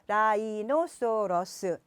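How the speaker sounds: 라이노소러스 slowly, every syllable given its own beat; six-syllable split. Shown as a demonstration. Mom teaching English by rote, 라이노소러스 in six syllables. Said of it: "여섯 개인데".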